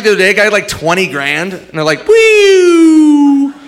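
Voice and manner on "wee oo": high, loud mock host cheer; sing-song mock host gloating. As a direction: ham up a game show host, much higher and louder. Mimicking Wheel host after bankrupt.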